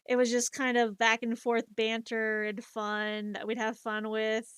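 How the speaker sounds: normal talking voice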